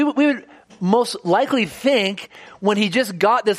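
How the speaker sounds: ordinary talk, nothing marked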